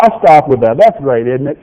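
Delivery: ordinary delivery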